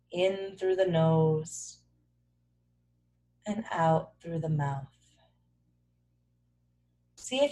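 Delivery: soft, low meditation voice with long pauses; calm, even body-scan narration; guided meditation. Acted. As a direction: guide them gently into stillness.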